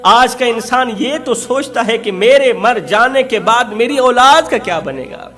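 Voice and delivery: usual speaking voice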